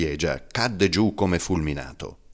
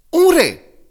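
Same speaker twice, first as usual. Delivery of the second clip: put-on children's shout; 12 dB louder than usual.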